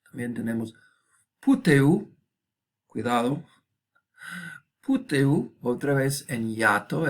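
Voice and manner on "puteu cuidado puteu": "puteu" in three syllables, pu-te-u; careful "puteu", no diphthong, pitched up; vowels in hiatus. Shown as a demonstration. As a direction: keep E and U separate in "puteu". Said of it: "esto no es diptongo".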